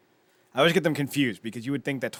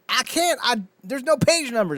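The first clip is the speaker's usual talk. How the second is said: a squeaky, much higher mock Shirley; high-pitched indignant Shirley; 11 semitones higher, 3 dB louder than usual. Retelling Shirley's protest to the professor, in a high mock voice. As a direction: pipe up high, mock-outraged.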